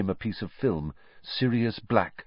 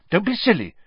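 high, louder, flustered; panicky; 14 semitones higher, 6 dB louder, faster than usual. A narrator's reading. Hermione denying Harry wants to kill, panicky. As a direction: rush it out in a panic. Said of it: "in a panicky voice".

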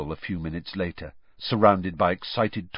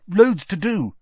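talking through a mouthful, higher; mouth full; 10 semitones higher, 6 dB louder than usual. Acted. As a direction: mumble it round a bite of food. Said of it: "said Hermione thickly".

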